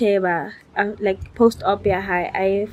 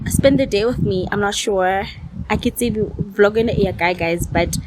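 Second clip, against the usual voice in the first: normal talking voice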